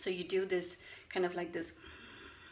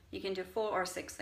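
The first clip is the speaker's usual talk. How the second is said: normal talking voice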